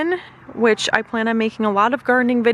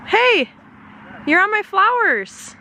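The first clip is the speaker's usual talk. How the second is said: calling out; 9 semitones higher, slower than usual. Real, not acted. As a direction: call out across the garden. Calling out to child in garden.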